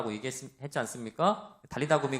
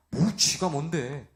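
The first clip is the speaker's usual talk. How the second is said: sulky retort; much slower than usual. Retold or imitated. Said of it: "기분이 나빠".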